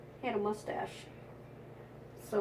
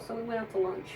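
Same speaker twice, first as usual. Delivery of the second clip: ordinary talk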